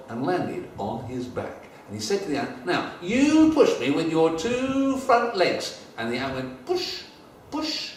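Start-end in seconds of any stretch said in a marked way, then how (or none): 2.7-5.8 high, slow, louder careful instructions; Ding Ding coaching the ant, high and deliberate; little cap instructing. Acted. 6.7-7.9 tiny ant's push. Acted. Ant pushing.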